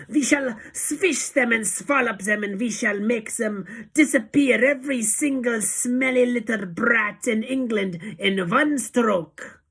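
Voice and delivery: high, loud witch's tirade, slowing at the end; Grand High Witch thundering. A narrator's reading. Witch leader vowing to destroy children, thundering rant. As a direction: thunder on, drawing out the last words. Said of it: "thundered the Grand High Witch".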